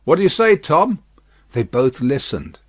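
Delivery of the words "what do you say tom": high, raised question; guard's raised voice; calling up. A narrator's reading. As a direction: call up to the driver. Guard calls up to coachman.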